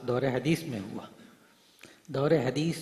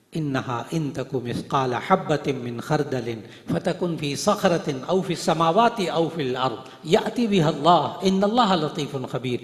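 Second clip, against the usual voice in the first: Quran recitation; 4 dB louder, slower than usual. Acted.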